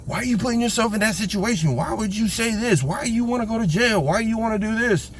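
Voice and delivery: high, pleading voice of the other guy; imitating the praying man as he pleads with them; pleading bystander. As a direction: pitch it way up and ask why, again and again. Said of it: "he's trying to say".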